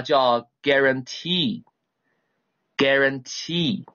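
slow, drawn-out teacher model reading of guarantee; teacher reading guarantee aloud slowly for the class. Shown as a demonstration. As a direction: say guarantee very slowly, syllable by syllable, for students to repeat. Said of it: "先跟我读这个啊".

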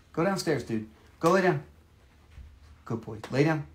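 soft commands to the dog; quiet command. Real, not acted. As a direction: gently order the dog down. Sending the dog downstairs.

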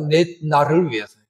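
ordinary talk, nothing marked

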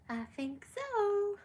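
high and slow, drawn-out coo; slow high pet voice. Real, not acted. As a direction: stretch it out up high like talking to a puppy. Repeat, answering for the dog.

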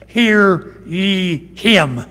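very slow, drawn-out solemn command; slow it right down like God speaking; voice from heaven. Acted. God the Father commanding the disciples, slow solemn voice.

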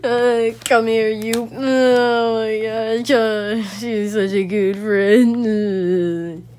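slow, drawn-out mock sobbing; emotional wailing. Gamer praising a good friend after winning, hammed-up emotional wailing. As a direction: wail tearfully, hamming up the joy. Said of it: "I'm being so emotional right now".

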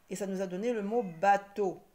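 ordinary talk

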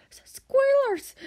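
high, loud terrified squeal; shriek of terror. A narrator's reading. Little dragon spots the squealers, high terrified shriek. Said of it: "gave a shriek of terror".

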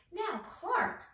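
high, drawn-out warning "Now"; teacherly warning. A narrator's reading. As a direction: gently scold a pupil. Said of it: "said Missus Inkydink".

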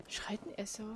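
a hushed aside, no voice at all; whisper. Real, not acted. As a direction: whisper it so nobody hears. Aside about a noisy neighbour. (leise geflüstert)

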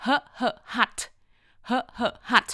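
"hut" built up from two short "huh" sounds; separated "huh, huh, hut"; phonics sounding-out. Shown as a demonstration. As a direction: puff the h twice, then say "hut". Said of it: "แต่ออกเสียงว่า".

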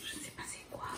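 soft unvoiced whisper. Real, not acted. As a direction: breathe it out to yourself. Whisper while opening box.

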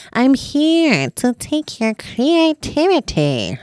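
slow, drawn-out demon threat; hammed-up slow demon proclamation; art demon voice. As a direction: loom over them like a playful demon. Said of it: "like, art demon".

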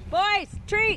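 high, loud call to the animals. Real, not acted. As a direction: holler them over for a treat. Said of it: "Sorry that was so loud".

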